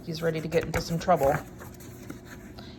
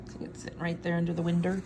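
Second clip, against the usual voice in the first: ordinary delivery, nothing marked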